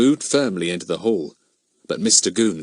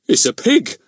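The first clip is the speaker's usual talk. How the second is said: disbelieving; 10 semitones higher, 6 dB louder than usual. A narrator's reading. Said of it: "hardly able to believe his ears".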